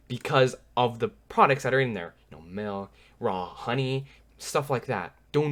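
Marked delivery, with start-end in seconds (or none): none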